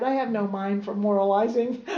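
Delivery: real laughter through the words; laughing. Real, not acted. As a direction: giggle the line out. Still laughing while reading.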